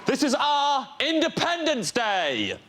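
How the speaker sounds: put-on lightly done Scottish accent, slow, high. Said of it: "imagine this in a Scottish accent".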